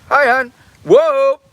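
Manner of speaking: high, loud startled hello; surprised greeting. Real, not acted. Streamer startled as his wife appears, high and loud. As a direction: caught off guard by his wife.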